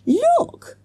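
put-on very high pleading child; urgent cry. A narrator's reading. Jessica interrupting her teacher, high urgent cry. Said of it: "cried Jessica again".

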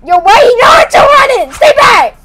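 high panicked yelling; being chased. Real, not acted. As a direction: scream at the chaser to stay back. Monster chasing, yelling.

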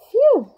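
high, loud breath of relief; loud relieved phew. A narrator's reading. Narrator sighing in relief with children. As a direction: blow it out big.

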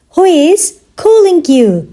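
measured language-lesson delivery; slow teaching repeat. Shown as a demonstration. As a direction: pause after "Who is". Teacher repeats question slowly for learners.

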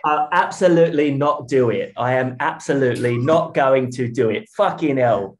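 mock-reluctant British accent performance. Shown as a demonstration. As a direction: put on a British accent, increasingly worked up. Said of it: "my British accent has been, you know".

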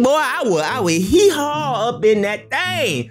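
excited leer. Real, not acted. Lusting over woman in clip.

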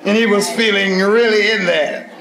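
usual speaking voice

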